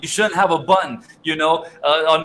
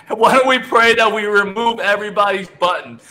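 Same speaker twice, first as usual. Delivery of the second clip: higher, amused, laughing the line; 6 semitones higher than usual. Real, not acted. Host applying the joke to everyone, laughing. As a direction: still cracking up. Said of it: "So I'm laughing because".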